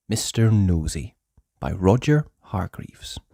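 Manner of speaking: ordinary delivery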